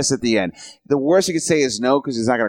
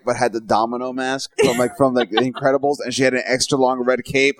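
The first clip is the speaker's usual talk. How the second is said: normal talking voice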